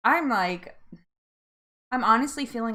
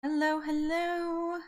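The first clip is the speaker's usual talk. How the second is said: high, slow, drawn-out hello; sing-song greeting; 6 semitones higher, much slower than usual. Real, not acted. Host welcoming early viewers to the stream, high and drawn-out sing-song.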